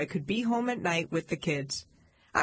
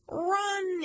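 high, stretched-out yell at modest volume; narrated cry of alarm, pitched way up; dad's yell; 12 semitones higher than usual. A narrator's reading. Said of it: "yelled Daddy".

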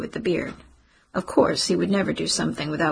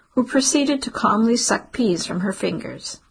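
ordinary delivery, nothing marked

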